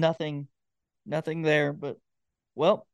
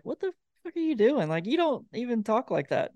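mock incredulous; 7 semitones higher than usual. Artists reacting to another singer's political song, mock incredulous. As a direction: imitate people baffled by what they hear.

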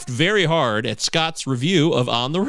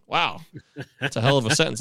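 ordinary talk, nothing marked

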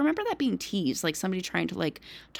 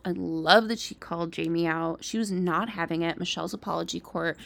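ordinary delivery

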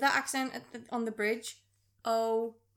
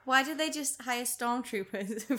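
ordinary talk, nothing marked

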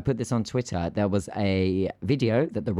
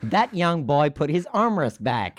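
higher, excited American tourist; hammed-up American accent, amazed gossip pitched up; 7 semitones higher than usual. American passenger telling Jerry about the armrest, excited American accent. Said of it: "everyone requests your American accent".

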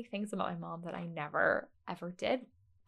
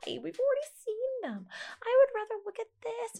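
very high mock whine; imitating exasperated teen. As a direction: protest like an embarrassed daughter. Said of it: "so embarrassed".

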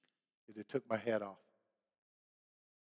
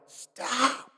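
drawn-out, high-pitched mock shout at reduced volume; retold yell; 7 semitones higher, 12 dB quieter than usual. Passenger to lieutenant as she rounds the corner, mock yell. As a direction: act out yelling a warning, but softly. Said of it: "and I yelled".

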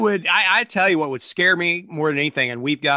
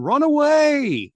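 slow, high cry of run away; drawn-out high Monty Python panic; 12 semitones higher, 4 dB louder, much slower than usual. Retold or imitated. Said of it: "the Monty Python".

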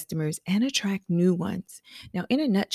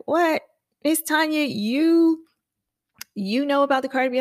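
surprised client; 8 semitones higher, 4 dB louder than usual. Retold or imitated. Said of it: "my client was like".

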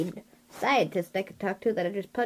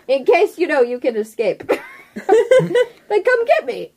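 laughing high and loud; 9 semitones higher, 10 dB louder than usual. Real, not acted. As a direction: giggle through the words. Laughing through the character's plan.

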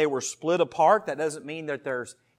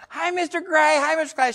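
put-on much higher, louder sing-song child greeting; kids calling out; 11 semitones higher, 6 dB louder than usual. Schoolkids greeting the substitute teacher, high and loud child voices. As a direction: chirp it like eager schoolkids. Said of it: "all the kids in her class going".

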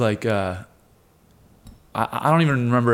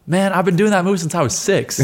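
higher-pitched, pumped-up quote; hyped interview boast; 7 semitones higher, 4 dB louder than usual. Retold or imitated. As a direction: re-enact bragging to the interviewer. Retells cocky interview answer.

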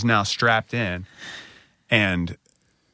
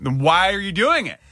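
high, indignant retort; retold dad snapping at the sick nurse; incredulous; 9 semitones higher than usual. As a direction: challenge him in disbelief. Confronting masked coughing IV nurse.

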